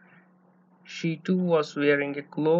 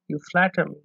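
ordinary delivery, nothing marked

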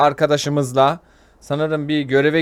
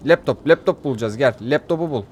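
normal talking voice

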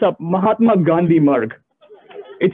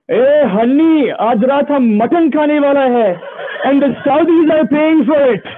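gloating shopkeeper; 7 semitones higher than usual. Retold or imitated. Shopkeeper gloats to his wife on the phone, higher and laughing. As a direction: crow over the win with a laugh. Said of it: "He's like".

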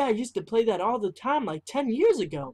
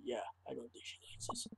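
muttered aside; 7 semitones lower, 19 dB quieter, faster than usual. Acted.

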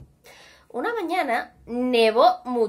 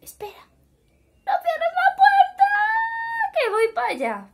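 high frantic shout; 5 dB louder than usual. A narrator's reading. Pig runs begging the door stay open.

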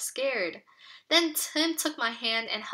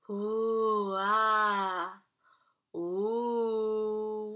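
drawn-out ghostly ooh-ah moan; low moaning sound; much slower than usual. Acted. Reader voices the mysterious moaning from the shed, eerie moan. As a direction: moan like something spooky in a shed. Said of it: "we heard a low mowing sound".

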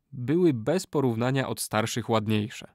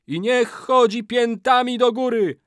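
very high, loud wailing cry; ritual curse; 12 semitones higher, 7 dB louder than usual. A narrator's reading. Old man ends his curse, high loud wail. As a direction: cry the climax of the incantation.

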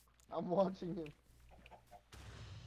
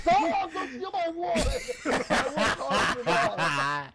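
much higher, louder outraged yell; 10 semitones higher, 8 dB louder, slower than usual. Real, not acted. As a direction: yell at the wood thief. Friend taking his wood.

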